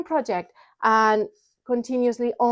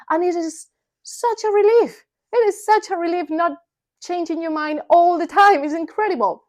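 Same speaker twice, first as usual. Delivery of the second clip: relieved; 7 semitones higher, 4 dB louder than usual. Real, not acted.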